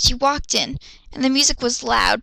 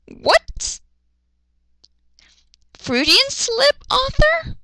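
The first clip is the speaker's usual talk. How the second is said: mock incredulous; 13 semitones higher, much slower than usual. Real, not acted. Mocking the author's slip.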